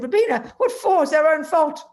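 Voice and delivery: high, loud, lightly amused retort; casual amusement. Retold or imitated. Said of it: "he just laughed very casually".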